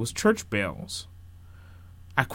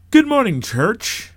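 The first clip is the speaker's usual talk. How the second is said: loud, high pulpit hello; projected preacher's voice; minister's greeting; 12 semitones higher, 8 dB louder than usual. A narrator's reading. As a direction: greet the whole congregation from the pulpit. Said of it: "the minister retorted".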